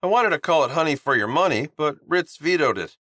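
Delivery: chirpy guy pitching his skincare line; cheerful Honeybun. Acted. Honeybun speaking.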